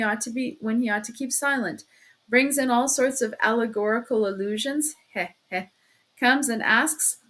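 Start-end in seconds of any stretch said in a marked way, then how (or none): 5.1-5.7 dry little "heh, heh"; low, short chuckles; sly chuckle. A narrator's reading. Investigator needling his suspect, sly chuckle.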